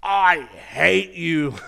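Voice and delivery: slow, drawn-out angry yell; hammed-up furious holler; furious skier. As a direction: stretch each word in loud, high outrage. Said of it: "he was just so mad, so furious".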